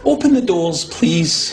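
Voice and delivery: polite request. Real, not acted. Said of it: "Maybe we should just say please".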